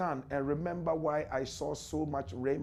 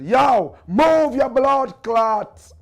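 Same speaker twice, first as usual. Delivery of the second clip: loud, slow mock Jamaican bark; Jamaican retort; 7 semitones higher, 14 dB louder, much slower than usual. How a Jamaican man would answer the American, loud slow mock Jamaican. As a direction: shout it out like a rough Jamaican man. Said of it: "A Jamaican man would have said".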